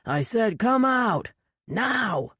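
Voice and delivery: slow, commanding shout; loud shout. A narrator's reading. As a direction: bellow the order across the river. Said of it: "raised his voice to a loud shout".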